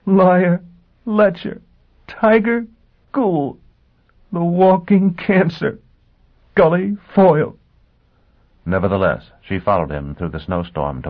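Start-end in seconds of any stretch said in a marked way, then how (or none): 0.0-7.6 laughing. A narrator's reading. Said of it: "She began to laugh".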